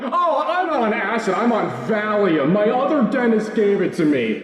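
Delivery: high, laughing loopy voice; mock deranged patient. Patient replaying himself as a loopy maniac to the dentist. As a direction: play the drugged-up maniac. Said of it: "And I'm like".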